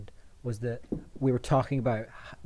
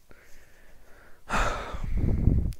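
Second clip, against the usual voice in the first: exasperated sigh. Pretend. Pasta lover lost for words, sighing hard. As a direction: huff out a long breath of disgust.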